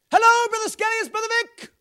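very high, shouted phone greeting; Brother Vic. Retold or imitated. Missionary phoning an old acquaintance, imitated as high shouting. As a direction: holler down the line in an impression. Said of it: "Why are you shouting?".

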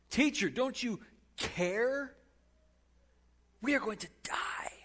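slow high plea falling to a whisper; beg, then breathe out the fear; fearful plea. Acted. Disciples desperately pleading with the sleeping Jesus.

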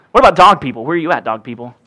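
usual speaking voice

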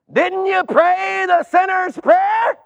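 a gatekeeper who can't believe it; exasperated gatekeeper. Acted. Gatekeeper questions a newcomer at heaven.

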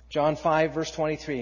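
usual speaking voice

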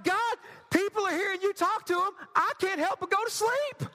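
high-pitched, comically helpless protest; exasperated plea. Acted. Young believer confessing to God he keeps falling asleep, exasperated. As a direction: pitch it way up, pleading your case.

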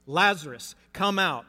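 loud commanding call to the tomb; Jesus calling into the tomb, loud command. Acted. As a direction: call him out of the grave at full voice. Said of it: "called out in a loud voice".

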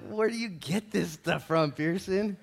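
slow, drawn-out mock disbelief; mock bewilderment. Teasing Pearson about his blunt question, mock baffled. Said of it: "I was like".

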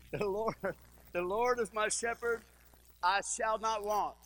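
a touch of solemn recitation; scripture recitation. Acted. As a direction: read it as holy text.

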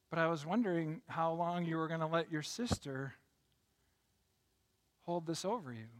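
soft, gentle grandmotherly reassurance; tender forgiveness. Acted. Grandma forgiving Tommy for the duck, soft and tender. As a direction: say it quietly and kindly, with room to pause.

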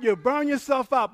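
ordinary talk

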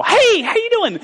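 very high, mock-perky greeting; squeaky-high hammed-up hello; chirpy morning wife. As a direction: imitate a wide-awake early riser. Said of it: "wake up at, like, five A M and just go".

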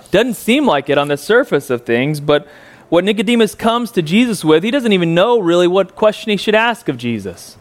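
normal talking voice

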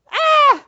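sudden high loud cry; mock shout. Preacher mimicking Peter's alarm, high loud yelp. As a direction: burst out with a yelp.

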